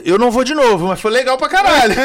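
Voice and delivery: high, loud, laughing; laughing daughter. Retold or imitated. Imitating his daughter laughing after the ride. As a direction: laugh it out, thrilled and relieved. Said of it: "e aí ela".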